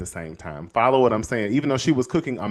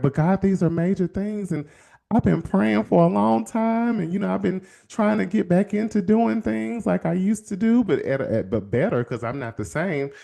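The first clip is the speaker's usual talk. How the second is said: higher, earnest plea; mild pleading; 7 semitones higher than usual. Retold or imitated. Speaker begging God to let him go, higher and pleading.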